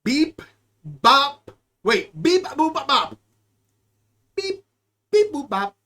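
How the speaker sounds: chirpy robot beeping; playful robot noises; robot beeps. Pretend. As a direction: beep it like a toy robot.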